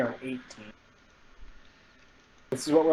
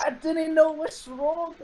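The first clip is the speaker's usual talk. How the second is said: high bewildered character voice; 13 semitones higher, slower than usual. Acted. Character looks at his hands.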